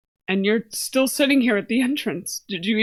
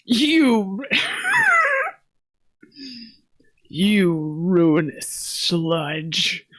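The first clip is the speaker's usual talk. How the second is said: disgusted; much slower than usual. Acted. Insulting the character.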